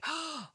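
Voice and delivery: sharp, soft intake of breath; mock gasp. Coaches reacting to being paid, acted gasp. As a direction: gasp as if suddenly unsure.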